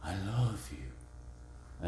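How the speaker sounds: slow, soft I love you; slow and tender. Shown as a demonstration. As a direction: draw it out gently. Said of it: "what if I do this?".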